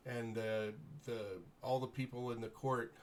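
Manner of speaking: usual speaking voice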